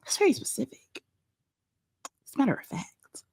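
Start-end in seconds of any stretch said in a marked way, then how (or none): 2.1-3.2 whispered aside. Real, not acted. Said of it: "aside said in a whisper".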